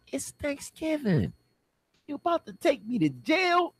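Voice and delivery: very high-pitched, whining protest; incredulous protest. Acted. Man protesting to officer about a Thanksgiving arrest, high incredulous protest. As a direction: plead your disbelief way up high.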